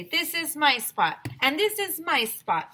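high, insistent claim; territorial pets. Acted. Pets stake out their territories.

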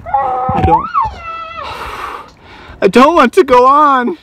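loud, high hammed-up gasping complaint; mock exhaustion. Dad hamming up tiredness after the stair run. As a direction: fake collapse after the stairs. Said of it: "No, I'm just kidding".

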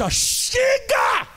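high shout of chega, slowly drawn out; a furious divine outburst, stretched long; god explodes. Acted. Pastor voicing God losing patience, drawn-out high shout.

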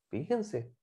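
usual speaking voice

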